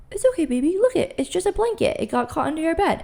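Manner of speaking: high, gentle reassurance to a scared little one; soothing a child. Pretend. Parent comforting a child scared of a ghost, high and tender. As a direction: coo it like calming a child at bedtime. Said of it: "you have to be like".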